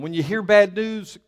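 ordinary delivery

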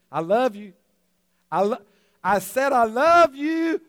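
insistent loud repeat. Retold or imitated. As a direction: demand an answer, calling it out again. Kim silent, he repeats louder.

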